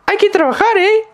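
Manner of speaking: sudden loud, very high parrot-like voice; crazy lady's squawk. Retold or imitated. Imitating crazy lady startling walkers at night, high parrot squawk. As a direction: squawk it out of nowhere. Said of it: "all of a sudden she's just like".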